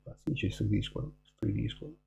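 normal talking voice

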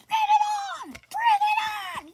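high, loud hype yell; party shout. Acted. Party guest screaming high to hype the crowd.

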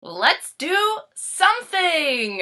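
drawn-out rallying call; triumphant cry. Acted. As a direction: kids cheering their new plan.

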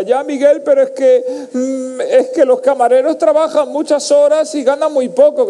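high, put-on wife voice; mock your wife's pleading nag; slow, high-pitched imitation of a pestering wife; nagging wife. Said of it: "mi mujer en los restaurantes me rompe la cabeza".

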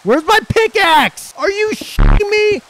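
very high, loud, slow exasperated cry; frantic shriek. Real, not acted. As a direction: shriek in frustration. Losing pickaxe in crisis.

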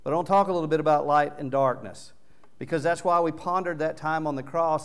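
normal talking voice

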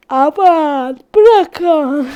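drawn out mid-yawn. Real, not acted. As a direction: say it through a big yawn.

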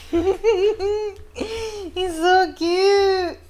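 high, loud, drawn-out adoring squeal; gushing. Real, not acted. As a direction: coo over something adorable, real delight. Reader gushes over the character.

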